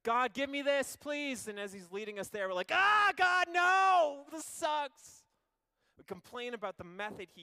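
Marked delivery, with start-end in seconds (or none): 0.0-1.5 loud, high pleading; whiny begging. Acted. Believer begging God for something, loud whiny plea. 2.7-5.2 loud, high tantrum groan; whiny shout. Acted. Believer complaining to God mid-journey, loud whiny shout.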